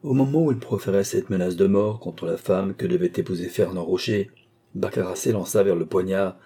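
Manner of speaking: usual speaking voice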